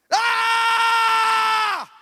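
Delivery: huge high war cry; battle shout. Shown as a demonstration. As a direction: roar like charging into battle. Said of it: "Hay diferencia". (grito de guerra fuerte y agudo)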